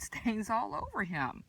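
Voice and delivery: ordinary delivery, nothing marked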